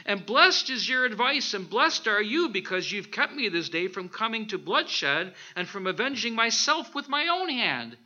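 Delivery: loud, ringing blessing from David; David's loud praise. Acted. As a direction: proclaim David's thanks with force. David praising Abigail, quoted scripture.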